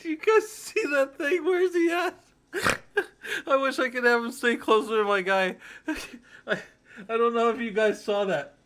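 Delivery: trying to calm down but still cracking up; giggly high voice, slowed on the last line; still laughing hard. Real, not acted. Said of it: "I gotta calm down".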